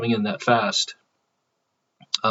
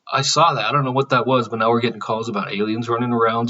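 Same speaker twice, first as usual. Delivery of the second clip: ordinary talk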